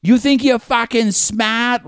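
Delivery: put-on slow, high, loud overdone Boston accent; mock Boston cop; Mark Wahlberg. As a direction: ham up a Boston tough with far too much mustard. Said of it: "putting mustard on it".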